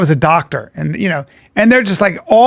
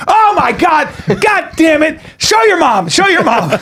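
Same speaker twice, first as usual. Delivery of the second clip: high, hammed-up outburst of horror; imitated scandalized exclamation, pitched way up; mock shocked father; 9 semitones higher than usual. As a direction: cry out like an appalled dad. Acts father's shocked discovery, punchline.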